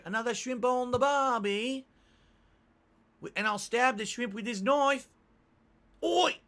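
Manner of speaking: high, loud, hammed-up Australian twang; a mock Australian shouting about shrimp; bad Australian accent. Shown as a demonstration. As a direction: overdo a terrible Australian accent. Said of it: "Absolutely terrible".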